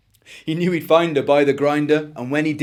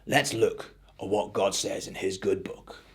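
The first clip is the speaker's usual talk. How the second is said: softer, lower hushed voice; whispered; 6 semitones lower, 8 dB quieter than usual. A narrator's reading. The old farmer whispering to Eric, soft and low. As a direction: say it softly like a quiet secret. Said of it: "smiled and whispered".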